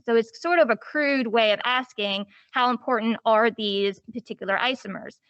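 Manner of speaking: usual speaking voice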